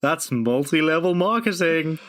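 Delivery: gleeful punchline. Pretend. Punchline of a planned-sounding bit.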